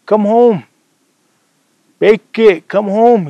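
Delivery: father shouting for his lost son; calling out. Retold or imitated. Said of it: "He'd be calling his name".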